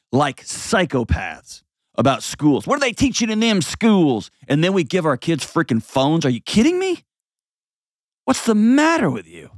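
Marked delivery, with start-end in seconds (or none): 2.7-4.3 high, exaggerated whiny parent voice; mocking complainer. Mocking parents complaining about schools. 6.2-9.5 high, exasperated disbelief; incredulous scolding. Real, not acted. Scolding parents who give kids phones.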